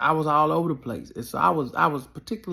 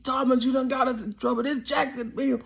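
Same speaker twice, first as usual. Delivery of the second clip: much higher, frantic fearful pleading; high panicky worried coach; paranoid coach; 8 semitones higher than usual. Retold or imitated. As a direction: whine like a terrified coach. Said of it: "My coach is paranoid".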